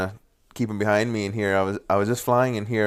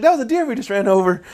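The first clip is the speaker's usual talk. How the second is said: amazed exclaim; 12 semitones higher, 3 dB louder than usual. Retold or imitated. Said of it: "I was like".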